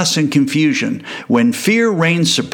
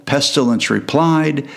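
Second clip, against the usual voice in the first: ordinary delivery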